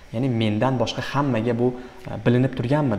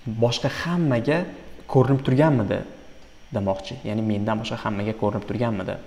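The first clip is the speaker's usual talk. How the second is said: usual speaking voice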